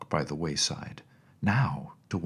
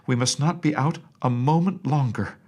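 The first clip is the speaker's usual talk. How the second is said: higher, louder urgent plea; anxious nurse; 6 semitones higher, 5 dB louder than usual. A narrator's reading. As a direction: hurry her along in alarm. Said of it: "The nurse almost cried".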